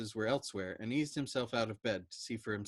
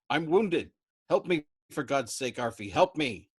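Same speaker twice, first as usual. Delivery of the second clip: loud, high frantic begging; wounded man shouting at a crewmate who can't hear; desperate cry for help; 3 semitones higher, 8 dB louder than usual. Acted. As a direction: plead loudly for your life.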